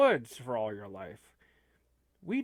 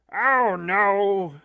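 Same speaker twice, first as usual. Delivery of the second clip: loud, higher groan of dismay; dismayed outcry; 4 semitones higher, 9 dB louder than usual. Acted. Hearing he went back to Slapton, mock-dismayed outcry. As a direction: cry out in mock dread. Reacts to friend's return to Slapton.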